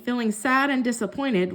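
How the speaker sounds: ordinary delivery, nothing marked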